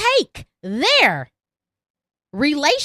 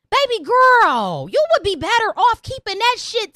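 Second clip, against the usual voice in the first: condescending; 5 semitones higher, 3 dB louder than usual. Real, not acted. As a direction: talk down sweetly. Condescending address.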